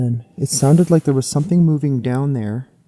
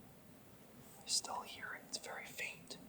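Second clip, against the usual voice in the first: whisper; 25 dB quieter than usual. Real, not acted. Listening for crying in woods.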